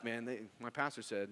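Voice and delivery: ordinary talk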